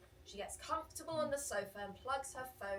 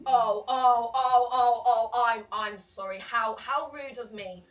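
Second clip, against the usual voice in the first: sarcastic fake apology; 3 semitones higher, 18 dB louder, much slower than usual. The rude customer mocking apology.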